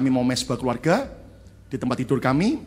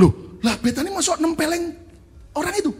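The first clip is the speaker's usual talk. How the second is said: raised, startled asking; surprised question; 14 semitones higher than usual. Retold or imitated. Father surprised his daughter slapped someone, rising voice. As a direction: act taken aback by the news.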